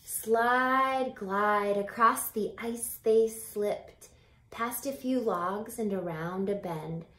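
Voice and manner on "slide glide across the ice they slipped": ordinary delivery, nothing marked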